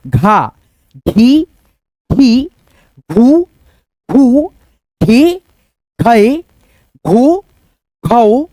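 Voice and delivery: each syllable said alone with a short pause after it; syllable recitation. Shown as a demonstration. Teacher chanting the barakhadi to students, one syllable at a time. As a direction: recite slowly for children to repeat.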